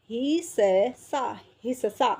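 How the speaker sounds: हिस्सा pulled apart slowly, hi-sa-saa; अक्षर तोड़कर. Shown as a demonstration. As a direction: sound out हिस्सा as three syllables with a full sa. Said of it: "ये आपका difference है इनमें". (हिस्सा को हि-स-सा बोलकर)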